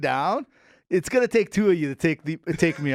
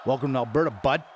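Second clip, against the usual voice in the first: put-on Alberta accent, low; Albertan accent; 6 semitones lower, faster than usual. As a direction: lay on a mock Albertan accent. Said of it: "you could talk in some accent".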